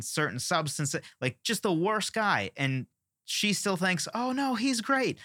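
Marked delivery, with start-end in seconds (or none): 4.1-5.2 much higher, slow and sing-song, mock naive; mock naive gush. Mocking the friend who still defends the worst guy, high naive gush. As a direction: gush like a blind friend who sees no flaws.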